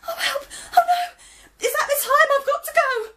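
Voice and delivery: high, loud and fast panic; frantic high cries; panicked cry. Acted. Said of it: "cried Gran".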